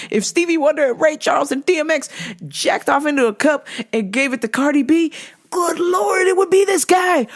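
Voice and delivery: building outrage. Real, not acted. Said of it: "wild comic exaggeration building".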